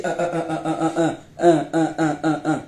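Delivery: mock stammering. Mocking a rival as a lying, incoherent talker, mock stammering. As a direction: imitate a liar stuck on one syllable. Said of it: "C'est les menteuses qui parlent comme ça".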